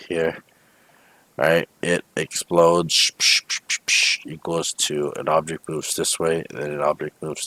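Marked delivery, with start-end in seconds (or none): 3.2-4.3 voiceless "psh" explosion noises; repeated hushy blast sounds made by mouth; imitated explosion bursts. Shown as a demonstration.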